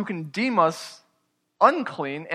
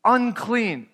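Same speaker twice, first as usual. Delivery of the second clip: higher-pitched imitated call; preacher mimicking a warning call; retold warning cry; 6 semitones higher, 3 dB louder than usual. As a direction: cry it out as a warning, lightly acted. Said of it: "they were supposed to cry out".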